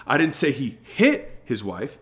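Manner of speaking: slow down and strike HIT; stress on HIT. Shown as a demonstration. Sales coach shows how emphasis changes meaning, stress on HIT. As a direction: HIT punched, with a beat after it. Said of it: "the emphasis that we put on the words".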